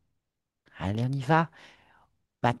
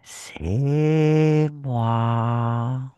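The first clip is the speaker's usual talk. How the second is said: ghostly whisper; 5 semitones lower, 3 dB louder, much slower than usual. Acted. Hypnotist speaks as dead Isabella.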